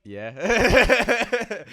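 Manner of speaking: hammed-up smug "Yeah", drawn out high and loud; mock posh yeah. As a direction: play the posh kid, owning it loudly. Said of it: "And I'm like".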